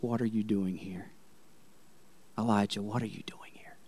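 hushed breathy whisper of God; soft, voiceless whisper to Elijah; still small voice. Retold or imitated. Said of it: "this still small voice came".

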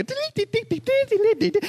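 high-pitched plinking dee-dee-dee; sing-song steel drum noises; steel drum imitation. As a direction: imitate a tinny keyboard playing steel drums. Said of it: "it sounded like a steel".

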